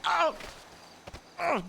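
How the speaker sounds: sudden high cry of pain; startled yelp. Real, not acted. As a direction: yelp as you get hit. Attacked by deer.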